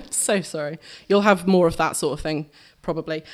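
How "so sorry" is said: apologising through laughter; laughing. Real, not acted. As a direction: laugh while you say sorry. Apologising again while laughing.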